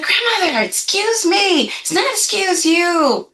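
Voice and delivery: high, drawn-out protest at grandmother; protesting grandchild. Retold or imitated. Younger self corrects her grandmother's saying, high drawn-out protest. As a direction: stretch it out high, correcting an elder. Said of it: "when I was younger ... And I'd be like".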